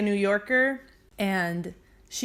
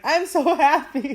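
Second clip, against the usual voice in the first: high, loud, drawn-out fake sob; mock crying; 6 semitones higher, 6 dB louder, slower than usual. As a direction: wail it comically through a laugh. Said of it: "he's crying".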